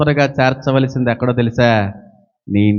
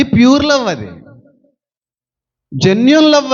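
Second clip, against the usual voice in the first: emphatic proclamation; 7 semitones higher, 5 dB louder than usual. Acted. Preacher proclaiming true love.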